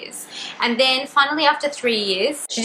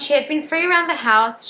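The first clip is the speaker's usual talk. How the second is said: plain reading voice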